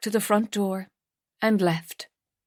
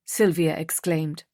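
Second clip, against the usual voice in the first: usual speaking voice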